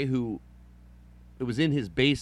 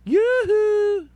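high, loud sing-song yoo-hoo; calling out; 9 dB louder than usual. Acted. Host calling out to listeners, in a loud sing-song yoo-hoo. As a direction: call out playfully across the room.

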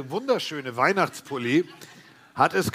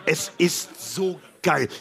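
slow, savoring every word; drawn out; much slower than usual. Real, not acted. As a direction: stretch it out with relish. Drawn out praise of stadium noise.

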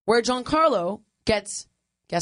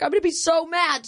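lightly voiced upset sister, higher; mock-panicked higher protest; freaking out; 7 semitones higher than usual. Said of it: "But she's like".

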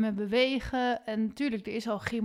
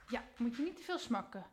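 quiet aside to dog; 12 dB quieter than usual. Real, not acted.

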